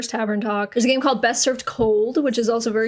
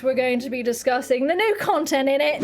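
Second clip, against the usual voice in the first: high-pitched British talk show host; British accent; 5 semitones higher than usual. Acted. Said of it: "my Brit talk from my streams".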